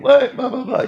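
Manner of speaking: high, loud retorting snap; mock defiant yell. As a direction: fire it back at the drill instructors. Gangster snapping on drill instructors.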